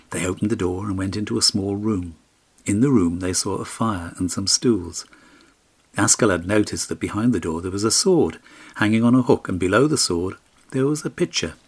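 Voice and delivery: ordinary delivery, nothing marked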